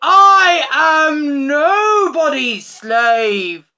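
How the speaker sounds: very loud, slow monstrous cry; demon crying out. A narrator's reading. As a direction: boom it as a defiant demon. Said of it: "cried the demon".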